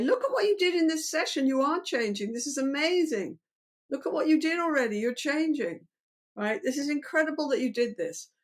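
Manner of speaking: enthusiastic validation. Shown as a demonstration. Therapist praising client's session, high excited gushing. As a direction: cheer them on like you would your dog. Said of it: "And we validate".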